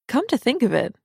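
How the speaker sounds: ordinary delivery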